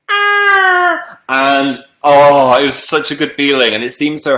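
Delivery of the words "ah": sudden high yelp as the koala grabs hold; startled yelp. Retold or imitated. Retelling the koala holding her arm, a sudden high yelp. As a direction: yelp like claws just gripped your arm. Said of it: "It was just like".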